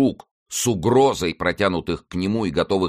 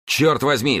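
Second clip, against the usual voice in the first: high, forceful outcry; officer's exclamation; 8 semitones higher, 4 dB louder, slower than usual. A narrator's reading. Officer finds the youth collapsing, high exclamation. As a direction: burst out, pitch jumping up. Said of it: "вскричал офицер".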